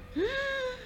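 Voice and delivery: un jadeo agudo y corto; jadeo de susto. Retold or imitated. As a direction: toma aire de golpe, aterrada. She wakes with someone on her. (jadeo agudo de terror)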